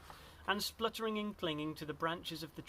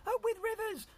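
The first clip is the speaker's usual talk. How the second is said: very high duckbill hoot; hooting cheer; 4 dB louder than usual. A narrator's reading. Duckbill herd chants happily, high hooting cheer. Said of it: "And they hooted".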